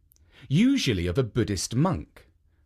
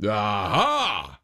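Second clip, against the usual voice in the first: ogre aha; 4 semitones lower than usual. Acted. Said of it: "cried the Oni".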